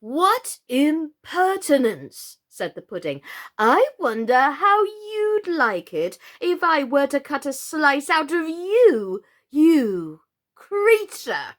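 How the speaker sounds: loud, slow, thick pudding voice; thick suety voice. A narrator's reading. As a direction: speak like an offended pudding. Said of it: "thick, suety sort of voice".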